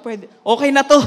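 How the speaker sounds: delighted burst of agreement; eager acceptance. Retold or imitated. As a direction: jump at the offer. Retells eagerly accepting the job.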